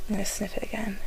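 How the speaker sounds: soft whisper. Real, not acted. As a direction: whisper it close. Whispering before sniff.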